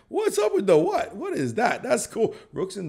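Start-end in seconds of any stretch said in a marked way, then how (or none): 0.1-1.1 much higher, laughing through it. Real, not acted. Streamer laughing at a chat rhyme, much higher. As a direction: crack up as you repeat the question.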